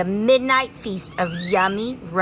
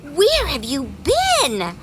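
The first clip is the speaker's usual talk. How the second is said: other bees asking; 7 semitones higher, slower than usual. Acted. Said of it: "asked the other bees".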